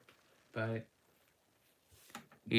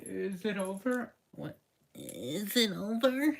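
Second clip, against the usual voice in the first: timid question; 8 semitones higher, 4 dB quieter than usual. Acted. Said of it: "from the corner and says".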